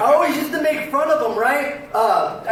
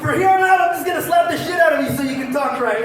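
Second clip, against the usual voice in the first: raised, exasperated threat; mom's mock scolding; 3 semitones higher, faster than usual. Mom threatening son who stops stuttering, scolding.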